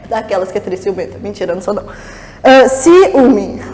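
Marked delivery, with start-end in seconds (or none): 2.7-3.6 "ciúme" as "ci... úme"; split hiatus. Shown as a demonstration. Teacher returns to the hiatus example, "ciúme" split in two. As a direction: separate the vowels "i" and "ú" in "ciúme".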